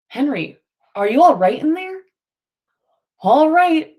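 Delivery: loud calls into the crate, then a loud answer; calling through a box. Acted. Men outside the crate calling loudly to Henry inside. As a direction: shout so he hears inside the box.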